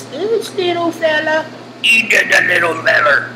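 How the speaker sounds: high-pitched playful character voice; cutesy little voice. Pretend. Teacher pointing out a small hidden clause, cutesy high voice. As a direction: squeak it like a tiny creature.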